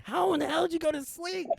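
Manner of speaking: high-pitched baffled demand; mock incredulous outburst. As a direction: act out squeaky disbelief. Retold disbelief at sleeping friend.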